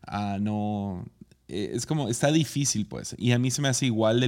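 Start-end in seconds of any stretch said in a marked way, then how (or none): none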